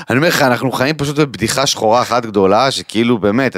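ordinary delivery

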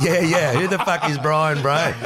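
high, loud scoffing who is he; hammed-up incredulous mate; mock disbelief. Voicing the county players.

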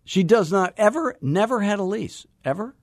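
high, disbelieving questions; incredulous. Real, not acted. Host challenges caller about the missing lease, incredulous and high. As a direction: press him in high disbelief, ever?.

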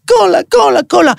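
high-pitched, insistent chant; mock childish yelling. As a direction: imitate someone endlessly screeching a demand. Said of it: "הוא כל הזמן יצעק". (צעקות דרישה ילדותיות, בחיקוי)

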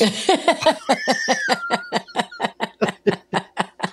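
long, high real laugh at his own punchline. Real, not acted. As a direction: crack up at the end of the joke.

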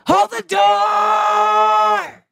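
stretched, high, loud wail; hammed-up wailing hold the door; anguished shout. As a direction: draw the cry out slow and pained. Quoting Hodor's death scene.

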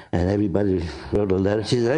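ordinary delivery

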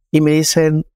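ordinary talk

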